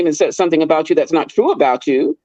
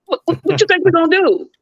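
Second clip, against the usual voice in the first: high, teasing call to passersby; mocking taunt; 13 semitones higher than usual. Teasing coworkers scared to pass the break room, mocking and high. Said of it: "I'm like".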